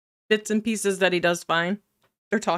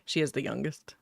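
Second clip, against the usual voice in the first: normal talking voice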